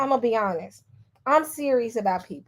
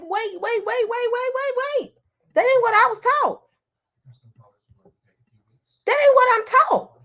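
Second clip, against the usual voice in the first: much higher, rising alarm on each wait; shocked disbelief; 12 semitones higher than usual. Retold or imitated. Reliving her reaction to Mike's chat in stunned disbelief. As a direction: replay your stunned reaction, climbing in pitch. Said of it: "'cause I'm like".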